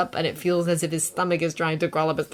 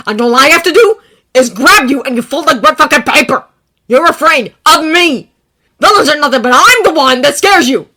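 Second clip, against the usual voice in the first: angry; 9 semitones higher, 15 dB louder than usual. A narrator's reading. Bakugo shouting.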